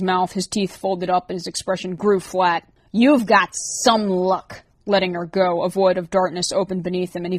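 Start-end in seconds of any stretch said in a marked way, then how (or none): 2.9-4.6 slow, drawn-out sneering threat; lightly voiced monster toying with his prey; menacing slow taunt. A narrator's reading. Creature lets go of the girl with a slow sneer.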